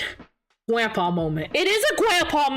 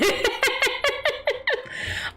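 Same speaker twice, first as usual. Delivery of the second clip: giggle; 13 semitones higher than usual. Real, not acted. Giggling at her own frustration.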